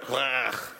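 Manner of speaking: "Ruach" with a harsh throaty ch; Hebrew word. Shown as a demonstration. Praising the class's "Ruach" and repeating it. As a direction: lean on the final ch of "Ruach". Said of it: "you guys did the cha at the end".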